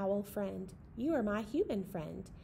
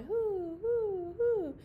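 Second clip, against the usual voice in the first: rising owl hoo, breaking high; owl hoots; 10 semitones higher, much slower than usual. A narrator's reading. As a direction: call out like a night owl. Owl call in narration.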